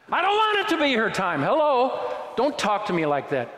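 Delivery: high, loud outburst of indignation; exasperated retort. Acted. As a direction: push back in hurt disbelief at an empty platitude. Snaps back at funeral cliché.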